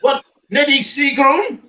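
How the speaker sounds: high, slow silly comic voice; Goon Show impression. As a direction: call the name in a daft high voice. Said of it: "you've got the Goon Show".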